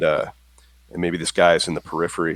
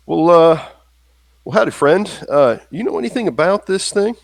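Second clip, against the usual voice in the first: put-on raised, loud opening in a Texan accent; 10 semitones higher, 5 dB louder than usual. Slim greeting the stranger about a statuette, Texan accent. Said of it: "with your Texan accent".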